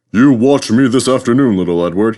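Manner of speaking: boasting. A narrator's reading. Gordon boasting to little Edward, loud and proud. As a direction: puff yourself up and show off. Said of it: "he boasted".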